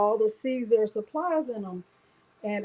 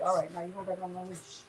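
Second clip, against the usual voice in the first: quiet aside to dog; 4 semitones lower, 11 dB quieter, faster than usual. Real, not acted.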